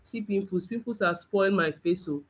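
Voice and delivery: soft mock lament over the mirror; quietly moaning, lightly acted; mock self-pity.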